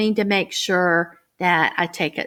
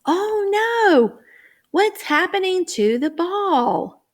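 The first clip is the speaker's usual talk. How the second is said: mock alarm; 10 semitones higher than usual. Shown as a demonstration. Teacher to young readers, playful alarm. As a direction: gasp oh no for the kids. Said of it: "Then turn the page".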